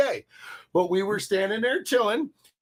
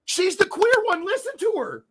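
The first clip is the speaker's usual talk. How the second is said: higher, incredulous retelling of himself; higher-pitched mock outrage at a friend; mock exasperated retort; 7 semitones higher, 8 dB louder than usual. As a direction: toss it back with disbelief.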